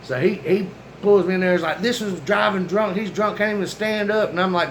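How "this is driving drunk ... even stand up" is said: higher-pitched imitation of an annoyed cop; retold mad cop. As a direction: report him accusingly, mock-angry. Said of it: "Now, he's mad".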